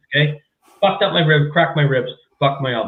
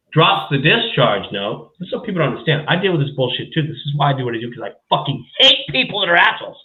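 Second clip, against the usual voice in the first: ordinary talk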